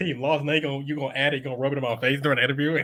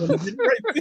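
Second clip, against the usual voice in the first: laughing retort. Retold or imitated. LeBron retorting to reporter.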